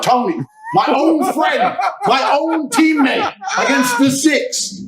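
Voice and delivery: high, loud, slow, laughing as he yells; laughing shout. Real, not acted. Guest recalling knocking out his friend, laughing shout. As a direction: disbelief bursting into laughter.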